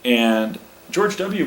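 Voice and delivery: usual speaking voice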